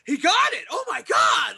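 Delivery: high, excited imitated shout; crowd going wild. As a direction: play a fan losing it over a win. Imitating crowd losing it at title win.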